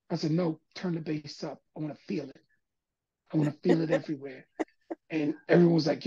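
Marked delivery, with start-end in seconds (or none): 0.3-4.5 quiet insistence. Retold or imitated. Retold reply to worried engineers.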